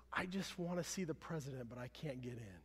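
softly voiced, understated imitation of crying; retold plea, quietly downcast and falling away; mild tearful soldier. Soldier explaining his tears to the young boy, mildly tearful. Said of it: "crying through his tears".

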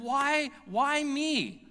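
slow, high, self-pitying whine; mock wail of injustice, drawn out; whiny plea. Ticketed driver to the officer, high slow whine. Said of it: "I literally said".

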